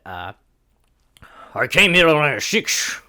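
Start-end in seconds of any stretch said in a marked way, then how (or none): 1.5-3.0 loud, hammed-up Irish actor voice; mock Irish accent. Comic reciting an Irish guy's tweet, mock Irish accent. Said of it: "like, a Liam Neeson voice".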